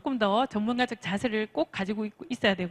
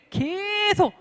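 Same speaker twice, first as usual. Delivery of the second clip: "계속" stretched long on a higher pitch; drawn-out emphasis; 9 semitones higher, much slower than usual. Shown as a demonstration. Coach urging to keep telling people, "계속" stretched out. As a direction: draw out the first syllable of "계속". Stretching the word 'keep on'.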